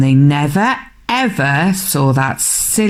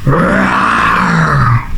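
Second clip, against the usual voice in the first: very low, rumbling monster roar; deep drawn-out roar; troll roar. A narrator's reading. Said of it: "roared the troll".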